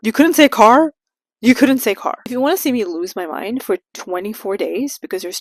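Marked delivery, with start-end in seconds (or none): 0.0-0.9 incredulous question. Real, not acted. Mocking the four-wheel-drive line. 1.4-2.2 deadpan statement. Real, not acted. Repeats as flat statement.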